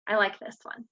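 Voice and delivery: normal talking voice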